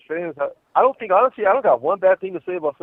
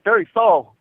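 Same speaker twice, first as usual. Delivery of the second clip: higher, louder put-on Aussie accent; attempted Australian; 6 semitones higher, 9 dB louder, slower than usual. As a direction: mimic how Australians say it. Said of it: "well, they say, like".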